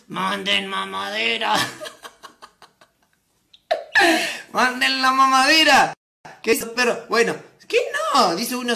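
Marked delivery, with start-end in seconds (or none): 0.1-5.9 very slow, stretched-out words; slow comic plea breaking into laughter; drawn-out plea. Pretend. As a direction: drag out each word, laughing. Comic drawn-out call to audience.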